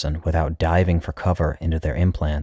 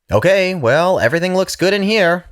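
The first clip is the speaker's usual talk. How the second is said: much higher, louder upbeat doctor; young doctor; 14 semitones higher, 5 dB louder than usual. Acted. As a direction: pitch it up, brisk and cheery. Young doctor voiced high.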